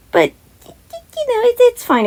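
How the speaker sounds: high, sing-song self-mockery; wry shrug. Real, not acted. Sarcastic reassurance after laugh.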